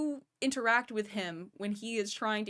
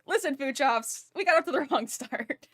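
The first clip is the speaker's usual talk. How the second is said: raised, louder mock plea; re-enacted appeal; 5 semitones higher, 6 dB louder than usual. Retelling an appeal to Food Chops, loud and raised. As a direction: push it out high and loud, half amused. Re-enacting his own past argument.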